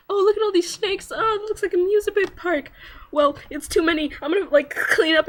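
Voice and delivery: a lightly voiced carefree kid; cute Fran. Fran to the snakes, airy and playful. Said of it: "He's all like".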